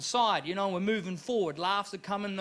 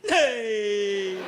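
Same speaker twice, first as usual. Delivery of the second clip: weird laugh imitation. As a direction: copy a strange audience laugh. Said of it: "This guy laughed like this".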